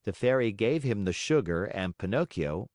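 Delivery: usual speaking voice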